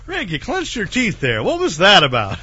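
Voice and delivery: laughing. Real, not acted. Laughing at friend's remark.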